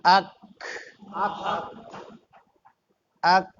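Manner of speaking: আক with a short bounced stop on ক; correct আক. Shown as a demonstration. Teacher corrects how students say it, crisp ক. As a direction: say আক cleanly, a little echo after ক. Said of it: "আপনারা উচ্চারণ করেন না".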